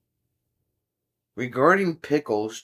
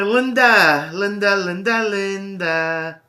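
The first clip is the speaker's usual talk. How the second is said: loud, much higher sing-song calls of "Linda", fading at the end; calling out; 7 semitones higher, 7 dB louder, slower than usual. Real, not acted. Streamer greets chatter Linda, loud playful calling. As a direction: call her over like she's across the house.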